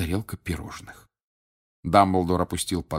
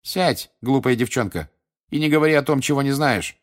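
cold; 7 semitones higher, 4 dB louder than usual. A narrator's reading. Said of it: "холодно сказала Скитер".

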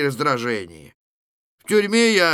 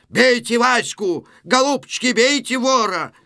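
inciting shout; 9 semitones higher, 5 dB louder than usual. Acted. Vasilisa setting the crowd on the thief, shouting. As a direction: urge the crowd on at a high pitch.

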